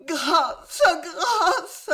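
high, sobbing cry for mercy; sobbing plea. Acted. The martyred wife begging for mercy: high sobbing plea. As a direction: sob the word out. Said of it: "sanglota l'épouse martyre".

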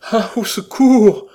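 a heart-rending, drawn-out shriek; piercing cry for help. A narrator's reading. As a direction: scream for help in terror. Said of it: "Ces derniers mots furent un cri déchirant".

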